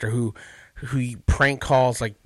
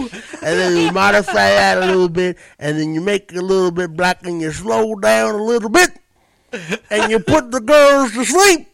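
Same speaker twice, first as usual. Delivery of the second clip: loud wrestler voice morphing into rambling comedian; Bill Cosby; 6 semitones higher, 7 dB louder than usual. Shown as a demonstration. Showing one impression turn into another, hammed-up Cosby. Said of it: "Bill Cosby is just, it's nothing more than a modified Ric Flair".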